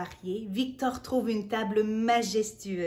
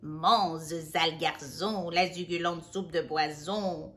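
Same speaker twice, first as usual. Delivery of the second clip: wicked fairy; 6 semitones lower than usual. A narrator's reading. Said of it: "la fée de la soupe insiste".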